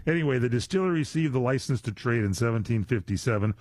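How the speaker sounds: ordinary talk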